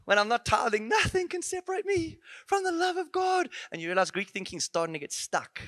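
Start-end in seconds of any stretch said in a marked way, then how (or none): 0.9-3.5 high, mocking sing-song. Preacher mocking a smug believer's excuse, high sing-song. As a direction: parody the smug believer, pitched way up.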